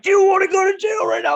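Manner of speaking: high, loud indignant yell; angry cop's threat. Retold or imitated. Cop threatening a kid with jail, angry high yell.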